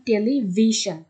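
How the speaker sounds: Television slowly, with "VI" stressed; television broken up, next-to-last syllable pushed; stress second from end. Shown as a demonstration. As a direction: lean on VI in television. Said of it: "ভির উপর আমরা একটা stress".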